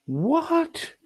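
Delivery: very high startled yelp of disbelief; incredulous what. Real, not acted. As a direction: squeak it out in shock. Sudden disbelief at streamer's claim.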